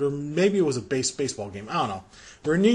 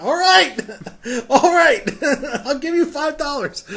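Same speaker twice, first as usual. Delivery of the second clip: high, laughing nervous give-in; laughing surrender; 9 semitones higher than usual. Retold or imitated. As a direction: cave in while cracking up. Nervously agreeing to pay rappers.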